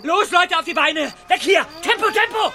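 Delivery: high, loud hurry-up yell; frantic yelling to run; panicked shout. Acted. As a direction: drive them out shouting. Henchman urges gang to flee.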